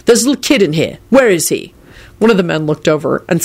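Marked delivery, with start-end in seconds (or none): none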